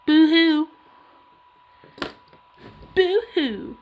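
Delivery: teasing boo-hoo; mock boo-hoo. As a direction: fake sob to tease.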